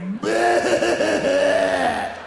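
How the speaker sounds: high, loud, shouted bleat; ugly sheep bleat. Retold or imitated. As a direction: bellow a nasty farm-sheep bleat. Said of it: "they're like".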